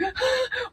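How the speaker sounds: sharp high panicked gasp. Retold or imitated. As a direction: gasp in mock alarm. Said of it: "so I'm all like".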